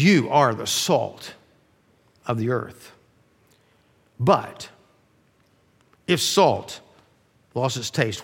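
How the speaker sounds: a quiet, personal word to close friends; said straight to his disciples. Retold or imitated. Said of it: "he's looking right at his disciples when he says".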